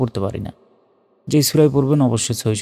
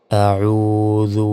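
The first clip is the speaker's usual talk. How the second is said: long, drawn tajweed chant; recited slowly; much slower than usual. Shown as a demonstration. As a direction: stretch আউযু out melodically. Recited model word.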